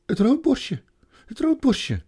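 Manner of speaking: high, loud excited calling; girl calling out about the robin. A narrator's reading. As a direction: call out to the others, pointing at the bird. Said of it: "riep Lucie".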